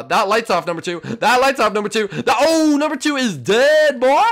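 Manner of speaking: high, loud gloating; excited taunt. Pretend. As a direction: crow over the kill.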